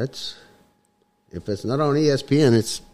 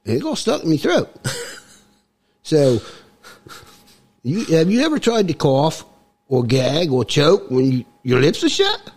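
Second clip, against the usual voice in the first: comic mock-Cockney accent; put-on Cockney accent; 4 semitones higher than usual. Guest explains his voice to the host, put-on Cockney accent. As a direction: talk in a hammed-up Cockney accent. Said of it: "it's a little bit Cockney".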